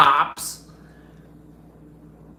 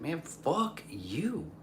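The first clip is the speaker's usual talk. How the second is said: softer, slow muttered insult; a quieter, slow mock brush-off; quiet slow mock curse; 4 semitones higher, 11 dB quieter, slower than usual. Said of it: "I was like".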